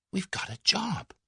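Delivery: hushed, breathless whisper of joy; excited whisper. A narrator's reading. Tuppence whispering the good news to Tommy, excited whisper. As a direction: whisper it like a secret treasure. Thrilled secret delight.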